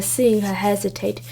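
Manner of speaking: usual speaking voice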